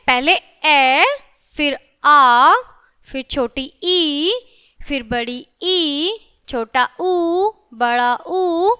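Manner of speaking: each vowel called out loud and drawn out, with a pause after it; loud, slow vowel chant for children; reciting vowels. Shown as a demonstration. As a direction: lead the class through the vowels one by one. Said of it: "मेरे साथ पढ़ेंगे".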